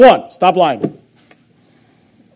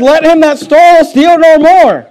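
much higher, louder and slower; climactic preaching; 7 semitones higher, 7 dB louder than usual. Acted. Preacher thundering the verse at the congregation, higher and louder.

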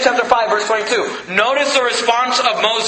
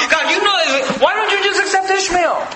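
pleading shout; 8 semitones higher than usual. Acted. Abraham pleading with God for Ishmael, shouting high. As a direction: cry out in protest to God.

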